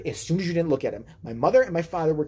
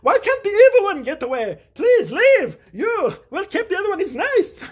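high, loud fed-up rant; hammed-up frantic whine; mock exasperation; 12 semitones higher, 6 dB louder than usual. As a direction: plead at the top of your range. Frantic dad, brother wishing him gone.

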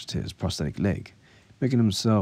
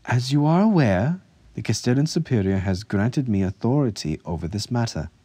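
raised, louder, controlled anger; livid; 5 semitones higher, 4 dB louder than usual. A narrator's reading. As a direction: assert authority with restrained fury. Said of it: "Ansef said lividly".

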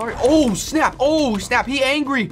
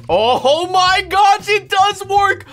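shocked delight; 12 semitones higher, 5 dB louder, slower than usual. Real, not acted. Minigun surprises him.

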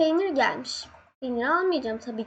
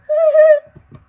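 shrieking scared laugh; 14 semitones higher, 14 dB louder than usual. Real, not acted. Scared by monster, apologizes after.

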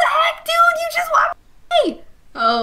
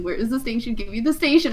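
usual speaking voice

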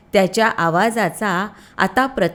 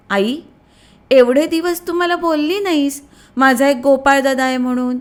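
put-on higher, lighter voice of a young boy; a curious little boy asking his mother; child's voice; 6 semitones higher, 4 dB louder than usual. A narrator's reading. Little Shyam asks his mother about his brother, a boy's voice.

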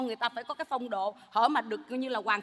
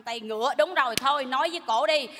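normal talking voice

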